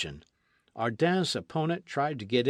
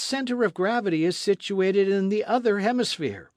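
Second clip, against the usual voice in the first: confident orator; 8 semitones higher, 4 dB louder than usual. Acted. Ardan explains his theory.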